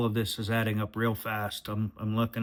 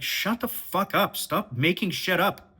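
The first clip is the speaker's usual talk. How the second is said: higher, slow, firm putdown; mock telling off; 7 semitones higher, slower than usual. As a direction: put your foot down, slowly and pointedly. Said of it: "put my foot down and be like".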